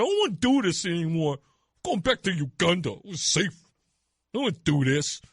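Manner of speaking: put-on whining, petulant child voice; Mamdani. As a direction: sulk like a kid who quits. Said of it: "like a petulant child".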